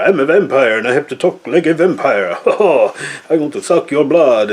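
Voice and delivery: slowed, mock-spooky vampire voice; a parody bloodsucker drawing out the threat; vampire impersonation. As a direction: ham up a cheesy movie vampire. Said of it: "Impersonation of a vampire".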